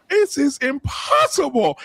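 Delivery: slow, high, drawn-out disbelief; incredulous. Retold or imitated. Scoffing at the movie fight, slow high disbelief. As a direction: stretch it out, mocking the scene. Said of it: "I was like".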